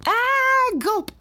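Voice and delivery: high drawn-out yell ending in a gulp; high falling-off-a-chair yelp. A narrator's reading. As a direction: cry out as you topple over, then gulp. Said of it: "Jason yelled as he fell".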